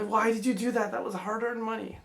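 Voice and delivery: high, gasping shocked mother. A narrator's reading. Mother protesting to father, high and shocked. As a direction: cry out in disbelief.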